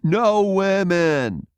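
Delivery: chorus shout. A narrator's reading. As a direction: shout it as a group of young men. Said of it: "This came in chorus from the group".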